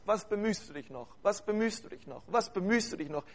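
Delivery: like a broken record playing in the mind; looping whisper of doubt. Preacher imitating the enemy's looped tape, soft and droning. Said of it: "auf dieser C D läuft immer nur ein Satz".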